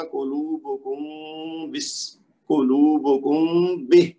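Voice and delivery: slow قلوبکم بہ, the meem stretched as a nasal hum before بہ; ikhfa with ghunnah. Shown as a demonstration. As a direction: hum the meem of قلوبکم, then release a short بہ. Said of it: "وہی ادائیگی کا طریقہ ہوگا".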